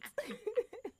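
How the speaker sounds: bright high-pitched laugh. Real, not acted. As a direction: burst into a high giggle. Laughing about funner.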